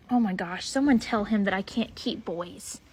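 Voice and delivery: ordinary delivery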